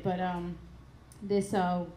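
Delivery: ordinary talk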